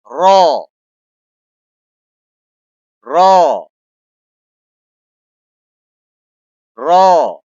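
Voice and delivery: رو said heavy and loud, tongue behind the teeth; رو with a heavy, deep-set sound; thick heavy letter. Shown as a demonstration. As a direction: make رو thick and full. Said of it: "پیچھے رکھ کے موٹا کرنا ہے".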